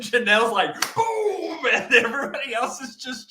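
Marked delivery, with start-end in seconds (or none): 0.9-1.6 high, playful mock boom. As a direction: pop it out like breaking free. Janelle breaking free, retold.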